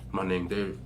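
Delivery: low, soft, smooth intro; deep quiet lady-killer tone; cool voice. Shown as a demonstration. Teen boy introducing himself to the girl, low soft cool voice. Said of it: "my voice right here".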